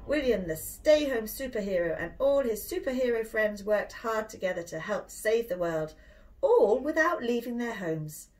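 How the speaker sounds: ordinary delivery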